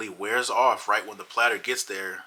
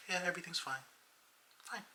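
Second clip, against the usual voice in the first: higher, soft and vacant, drifting off; spaced-out girlfriend; 9 semitones higher, 12 dB quieter, faster than usual. Retold or imitated. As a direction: play her dazed and far away.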